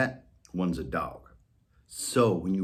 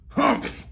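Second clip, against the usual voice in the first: high, louder huff; offended humph; 4 dB louder than usual. Acted. Molly huffing at Whiskers, high offended humph. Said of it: "said Molly".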